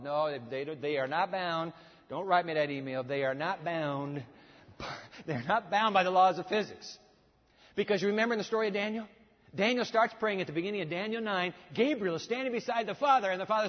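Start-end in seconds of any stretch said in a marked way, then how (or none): none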